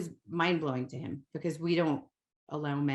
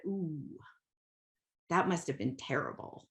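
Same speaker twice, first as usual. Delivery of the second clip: soothing echo; 4 semitones lower, 6 dB quieter than usual. Retold or imitated. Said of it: "I heard your voice in my head".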